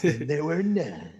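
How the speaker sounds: low deadpan line. Retold or imitated. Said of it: "it was just like".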